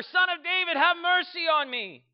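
high, loud cry, last words stretched; full-voiced desperate calling; insistent shout. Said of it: "he shouted all the more".

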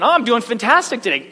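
high, gushing mock-cheerful reply; hammed-up chirpy greeting; involuntary cheerfulness. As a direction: blurt out over-bright enthusiasm. Involuntary cheerful answer despite being mad.

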